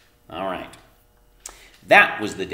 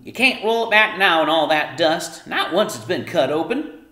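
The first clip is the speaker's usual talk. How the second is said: loud, higher cross mother voice; Homily scolding; 8 semitones higher, 7 dB louder than usual. A narrator's reading. Mother scolding daughter about dust, loud cross voice. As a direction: keep scolding her.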